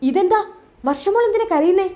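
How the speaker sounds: high-pitched worried question; lightly acted alarm; concerned surprise. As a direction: ask as if startled by tears. Acted father finds Varsha crying.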